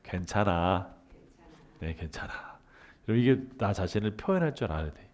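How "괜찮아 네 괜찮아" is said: soft self-reassurance. Shown as a demonstration. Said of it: "한번 이렇게 나한테 해볼까요?".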